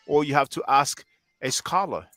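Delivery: ordinary talk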